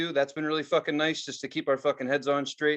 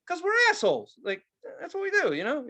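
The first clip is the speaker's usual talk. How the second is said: much higher, mocking sing-song self-quote; 14 semitones higher than usual. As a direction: toss it off in a squeaky mock voice. Retold reply to jackass guy.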